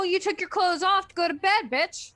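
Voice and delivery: ordinary talk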